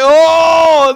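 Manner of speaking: loud, high drawn-out "oh"; mock alarmed groan. Barbershop men seeing a man sob, loud mock dismay. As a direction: imitate a roomful of men taken aback.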